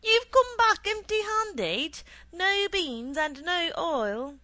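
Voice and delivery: very high, loud, drawn-out disbelief; astonished. A narrator's reading. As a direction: cry out in astonishment, slowly. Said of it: "called out in astonishment".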